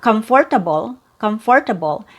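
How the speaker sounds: "comfortable" with a full "for" syllable; "comfortable" with the middle R kept; unreduced pronunciation. Shown as a demonstration. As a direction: say "comfortable" in four syllables, "kum-for-tuh-bul". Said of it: "It's not comfortable".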